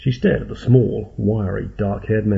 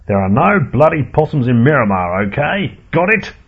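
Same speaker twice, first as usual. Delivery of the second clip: louder, climbing higher on each question; shouted; 5 semitones higher, 6 dB louder than usual. A narrator's reading. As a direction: bark orders at the pest crew. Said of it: "shouted Henry Mansfield".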